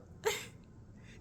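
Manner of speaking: bref rire soufflé; rire amusé. Real, not acted. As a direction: laisser échapper un rire ébahi, sans moquerie. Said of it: "Je ris pas pour me moquer". (petit rire spontané)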